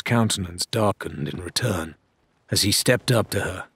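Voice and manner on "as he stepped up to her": plain reading voice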